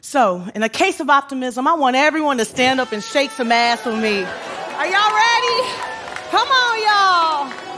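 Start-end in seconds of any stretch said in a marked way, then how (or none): 4.8-7.7 hyping the crowd. Real, not acted. Speaker rallies the standing audience, high excited call-out. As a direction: pump the crowd up.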